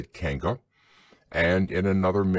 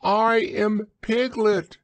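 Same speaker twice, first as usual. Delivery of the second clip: high, loud, slow squeaky protest; loud Piglet voice; 14 semitones higher, 5 dB louder, slower than usual. A narrator's reading. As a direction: insist loudly in a tiny voice. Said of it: "said Piglet loudly".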